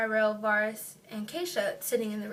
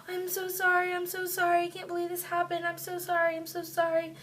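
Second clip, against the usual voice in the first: high, distressed retold apology; freaking out; 6 semitones higher than usual. Keisha holding the injured teller's leg, distressed apology. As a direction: imitate a friend's upset, repeated apologizing. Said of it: "everybody's just freaking out".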